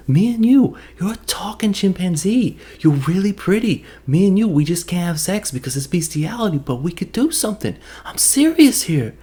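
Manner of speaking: high-pitched, hammed-up earnest suitor; smitten movie hero. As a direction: chat up the ape in a pleading high voice. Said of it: "the real cutscene was".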